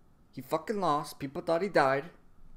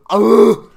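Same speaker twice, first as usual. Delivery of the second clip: loud, high imitated roar; mock monstrous roar; 10 semitones higher, 21 dB louder than usual. Showing what Katakuri's mouth does, loud imitated roar. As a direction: let out a big open-mouthed yell. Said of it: "his fucking mouth goes".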